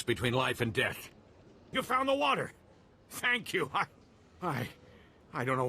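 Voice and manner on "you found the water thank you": high, amazed cry of relief; overjoyed. Acted. Farmer thanking the Jedi who found the water, overjoyed. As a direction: burst out with high, grateful joy.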